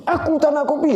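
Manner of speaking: a furious husband turning down coffee, mimicked; angry refusal. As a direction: imitate the father brushing off the coffee. Raging father refuses the coffee.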